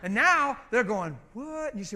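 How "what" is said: high-pitched stunned reaction; imitated astonishment. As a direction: mimic a freaked-out stranger, pitched up. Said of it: "they're already freaked out".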